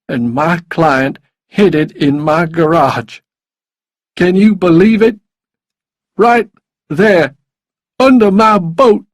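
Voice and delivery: agitated character voice, rising to a near shout; desperate drinking lawyer. A narrator's reading. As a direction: build from explanation to loud, outraged disbelief. Narrator plays desperate lawyer.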